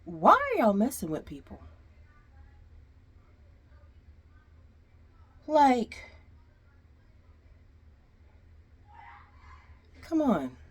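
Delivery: fed up, calling them out; exasperated rant. Real, not acted. Frustrated rant at management.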